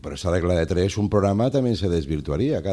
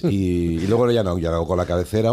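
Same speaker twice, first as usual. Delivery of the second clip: ordinary talk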